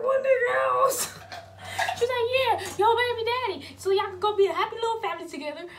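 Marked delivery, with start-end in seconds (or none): 0.0-1.2 high, loud, drawn-out disbelief; shocked. Retold or imitated. Daughter asking mom where she should go, shocked. Retold shocked reply to mother. 2.4-5.7 loud, high mock taunt; super mad. Mom sending daughter to baby daddy, super mad. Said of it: "super mad".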